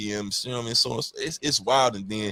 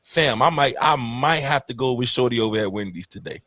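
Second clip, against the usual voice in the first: normal talking voice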